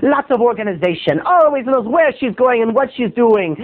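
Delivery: high, lecturing boss voice; mock prissy boss lecturing the waiter; snooty boss. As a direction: scold him like a spoiled boss. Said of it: "And he'd say".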